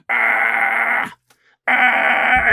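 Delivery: sustained bleating "aah", imitated; goat noise. Shown as a demonstration. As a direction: hold out a droning animal cry. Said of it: "it's making that noise".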